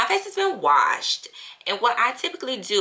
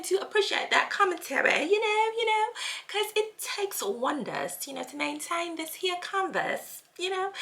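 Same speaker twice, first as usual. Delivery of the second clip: higher, softer put-on posh accent; 8 semitones higher, 4 dB quieter than usual. Thanking the commenter in her switch-up accent. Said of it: "my little accent that I can do".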